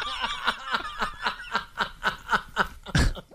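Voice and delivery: long, high laughing fit; real cracking up, trailing on and on; helpless laughter. Real, not acted. Teller cracking up at own cat story, long high laugh.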